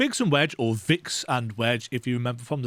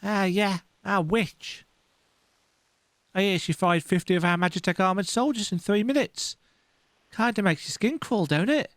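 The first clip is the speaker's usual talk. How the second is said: higher voice, a touch spooked; higher uneasy soldier; 6 semitones higher than usual. Acted. Young soldier gossiping about the witch, higher and uneasy. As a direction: read it as a nervous young trooper. Voicing game dialogue.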